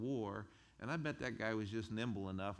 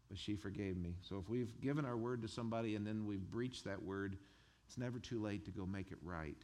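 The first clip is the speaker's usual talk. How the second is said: usual speaking voice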